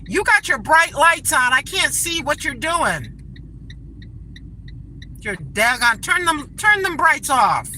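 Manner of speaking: annoyed driver yelling at oncoming headlights. Real, not acted. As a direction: tell off the driver with the brights on. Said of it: "I'm yelling at people".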